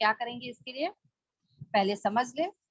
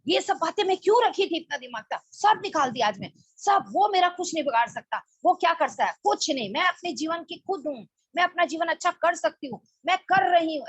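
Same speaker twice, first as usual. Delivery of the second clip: venting anger aloud; 6 semitones higher than usual. Shown as a demonstration. Teacher demonstrates letting anger out alone in a room, high and forceful. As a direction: declare it to the room, shaking off the resentment. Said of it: "या फिर ऐसे बोले".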